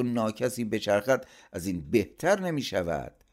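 high, scornful rant; angry scolding. A narrator's reading. Uncle berates sister and her idle son, angry. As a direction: berate them with pitch well up.

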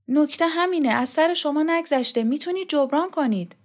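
put-on puzzled young man pressing his point; Borzu's voice. Borzu arguing eagerly.

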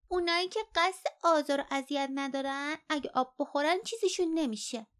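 high little-one voice, parroting; child reciting lesson. A narrator's reading. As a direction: recite it like a child who was taught it.

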